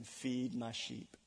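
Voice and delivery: slow, soft, tender instruction; a hint of a tender Jesus; gentle command. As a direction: lay it down gently.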